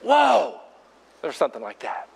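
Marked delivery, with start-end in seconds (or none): 0.0-0.6 put-on amazed kid whoa, higher; imitating kids' whoa. Kids seeing the tunnel, amazed whoa. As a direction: play the kids' wonder. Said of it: "you know what they did".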